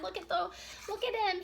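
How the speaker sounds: normal talking voice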